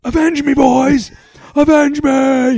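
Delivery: high, loud, drawn-out shout; movie battle cry. Acted. Quoting Red Dawn after invasion joke, hammed-up desperate yell. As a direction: holler it like a hammed-up movie hero. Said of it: "it's like Red Dawn".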